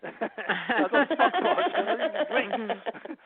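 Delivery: high, slow words broken by laughter; laughing. Real, not acted. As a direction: protest while cracking up laughing. Laughs recalling being thought stoned.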